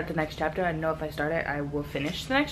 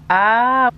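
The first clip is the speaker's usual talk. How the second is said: excited squeal; 5 semitones higher, 10 dB louder than usual. Real, not acted. Squeal of delight at book.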